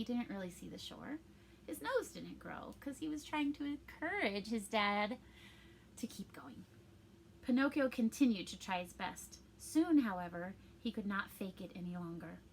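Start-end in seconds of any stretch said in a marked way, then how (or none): 6.0-6.7 whisper. Real, not acted. Trailing whisper.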